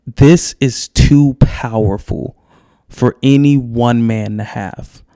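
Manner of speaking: very slow, weighty pronouncement; solemn priest. Acted. Priest resolves to hide the solution, slow and solemn. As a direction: draw each word out with gravity. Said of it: "And he's like".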